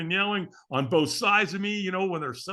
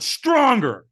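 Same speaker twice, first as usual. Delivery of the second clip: raised, high, forceful command; loud coaching demand; 8 semitones higher, 9 dB louder than usual. Retold or imitated. Trainer pressing the firewalker for more, loud coaching demand. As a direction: push him for more power. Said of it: "he screamed at me".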